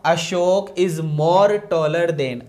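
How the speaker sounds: the wrong sentence read out slowly; faulty sentence dictated slowly; slow wrong version. Shown as a demonstration. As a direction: lay out the mistake word by word. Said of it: "इसलिए हम more को गलत करेंगे।".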